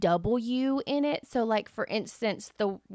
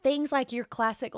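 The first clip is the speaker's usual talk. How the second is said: normal talking voice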